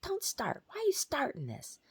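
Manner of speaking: high-pitched imitation of an angry father; mock yelling dad. Angry dad scolds his daughter in a dream, high mock yell. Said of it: "he starts yelling at me".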